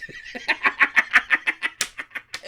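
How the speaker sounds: helpless wheezing laugh at his own joke; breathy cackle. Real, not acted. Laughing hard at his own old snap. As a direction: break up into airy laughter.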